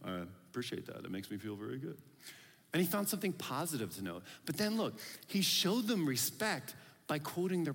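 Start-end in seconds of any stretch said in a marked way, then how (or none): 0.0-2.0 softer and lower, a touch of pleased modesty; quiet, lightly voiced gratified crowd; flattered Greeks. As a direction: murmur it like a gently flattered listener. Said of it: "the Greeks were probably like".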